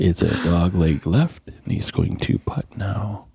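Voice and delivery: slow, hushed golf announcer whisper; golf commentator. Pretend. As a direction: murmur it slowly like TV golf. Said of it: "you gotta do it in the golf voice".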